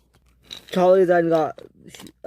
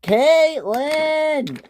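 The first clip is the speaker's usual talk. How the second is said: high, long furious name call; acted angry boss yelling; mad yell; 12 semitones higher, 3 dB louder than usual. A narrator's reading. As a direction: bellow the name, stretching both syllables. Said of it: "Topham Hatt was mad".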